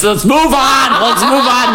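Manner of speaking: high, laughing cry of let's move on; laughing shout. Real, not acted. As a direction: shout it through a burst of laughter. Laughing at being cryptic.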